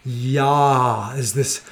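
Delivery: slow, low breathy Yah sigh; breathy release. Shown as a demonstration. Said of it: "sorry if I just breathed into your ear".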